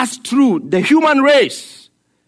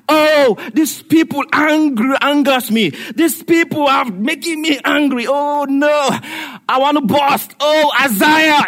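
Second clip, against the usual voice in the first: hammed-up angry God; 6 semitones higher than usual. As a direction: play God about to burst with anger. God voiced angry to Isaiah.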